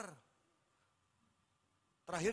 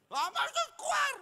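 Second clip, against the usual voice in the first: much higher, shouted exorcism command; mock exorcist shout; 9 dB louder than usual. Pastor mimicking friend casting out demon, hammed-up shout. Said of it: "teman saya ngusir setan".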